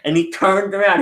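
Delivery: normal talking voice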